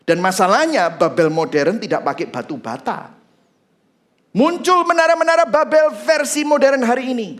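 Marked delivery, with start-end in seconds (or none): 4.3-7.4 high, loud proclamation; full-force preaching. Real, not acted. Preacher warns the congregation of modern Babel, full-force preaching. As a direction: announce it forcefully to the church.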